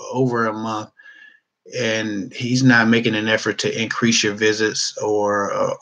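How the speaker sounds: ordinary talk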